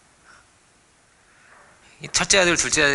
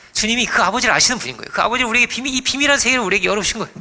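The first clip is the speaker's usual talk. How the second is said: higher, louder preaching push; rising fervor; 7 semitones higher, 4 dB louder than usual. Real, not acted. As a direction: build it with passion. Preaching climax.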